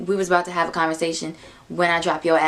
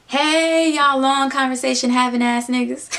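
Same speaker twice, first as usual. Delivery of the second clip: teasing call-out; 5 semitones higher, 4 dB louder than usual. Retold or imitated. Best friend greeting the pair in the car, loud teasing call-out. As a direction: loudly hail them to needle them.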